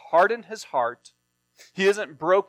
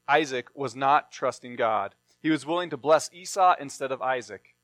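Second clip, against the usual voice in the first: ordinary talk, nothing marked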